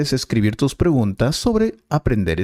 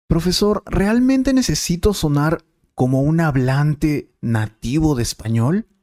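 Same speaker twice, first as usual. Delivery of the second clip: higher, eager student asking the teacher; 5 semitones higher than usual. Acted. Student thanks the teacher and asks, in a higher eager voice. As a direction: play the keen student, pitched up.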